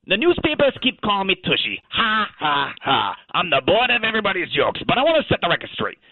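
casual Australian talking dog; chatty Aussie caller, thick Australian accent. Acted.

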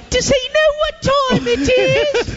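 high-pitched mock British voice; fake British accent. Mocking friend's fake British accent to a stranger. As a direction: put on a squeaky British accent. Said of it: "fake your British accents".

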